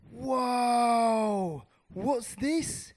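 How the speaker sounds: long, high, loud whoa of wonder; amazed. Acted. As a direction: cry out in awe at a new world. Mouse first sees beyond the wall.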